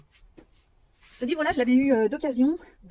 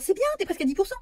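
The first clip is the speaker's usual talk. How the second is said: high, chirpy mock praise; a lightly voiced encouraging fan; imagined encouraging viewer; 8 semitones higher, faster than usual. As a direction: play a cheery viewer patting her on the back. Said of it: "Alors, on va me dire".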